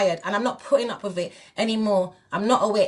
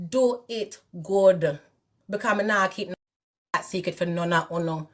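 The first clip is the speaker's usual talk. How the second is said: Jamaican patois. Real, not acted. Switches into Jamaican patois warning.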